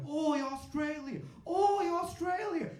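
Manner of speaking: very high, drawn-out yell; loud, slow, hammed-up Aussie call; rowdy Australian greeting. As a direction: holler across the room to a fellow Australian. Australians greeting across the room.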